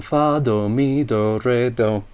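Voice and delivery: ordinary talk, nothing marked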